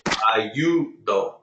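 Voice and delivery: ayudo slowly, broken up with stress on YU, a-YU-do; second-to-last stress. Shown as a demonstration. Teacher demonstrating ayudo to the class, slow, stressed on YU. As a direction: lean long on the YU of ayudo. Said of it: "goes on the second to last syllable".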